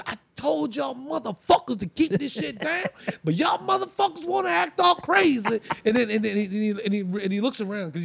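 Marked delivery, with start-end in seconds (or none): 0.1-5.6 high, strained furious rant; so mad. Retold or imitated. Uncle slamming the door open on the boys, so mad. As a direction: imitate an enraged uncle chewing everyone out, voice way up. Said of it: "he's just so mad".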